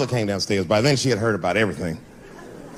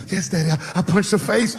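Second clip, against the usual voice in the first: put-on higher small-boy voice; little boy; 6 semitones higher than usual. Son admitting to dad he punched a girl, small boy voice. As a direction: confess like a sheepish little kid. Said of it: "He said".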